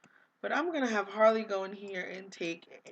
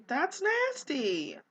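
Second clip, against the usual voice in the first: disgusted, drawn out; 7 semitones higher, slower than usual. Real, not acted. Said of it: "Don't eat that".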